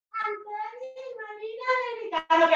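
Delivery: very high, sung-out complaint; wailing, strung-out high voice; high-pitched protest. Acted. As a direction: cry it out far above normal pitch. Acted quarrel, high cry out.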